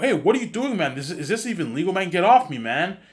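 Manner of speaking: high, loud panicked protest; protesting shout. Retold or imitated. Teen grabbed by three agents, loud high protest. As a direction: yell at the agents to let go.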